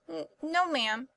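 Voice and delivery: high, hesitant stammer; bashful. A narrator's reading. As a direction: stammer shyly to a fine lady. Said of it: "he answered with a blush".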